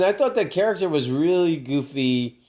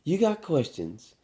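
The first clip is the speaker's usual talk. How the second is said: mock grandma quote; 6 semitones higher than usual. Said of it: "retelling grandma's line".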